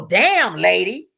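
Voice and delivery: loud mock outburst; imitated anger. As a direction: hit both words like an aggressive ex. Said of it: "So he real mad".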